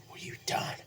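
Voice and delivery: breathless whisper of shock. Retold or imitated. Harry whispers in shock over his father's body. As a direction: whisper it, stunned.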